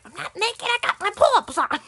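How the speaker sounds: high-pitched mock-tantrum protest; retold tantrum, squealed high; indignant child's protest. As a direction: protest like an upset little kid, voice jumping up.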